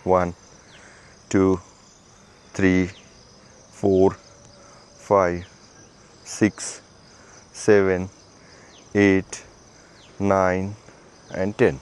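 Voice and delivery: low, level rep count; exercise counting. Acted. Instructor counting shoulder rotations, steady low count. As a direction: count evenly, one word per movement.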